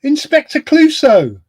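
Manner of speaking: high, loud outraged exclamation; can't believe they don't know; indignant disbelief. Real, not acted. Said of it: "I've never heard [name] so upset".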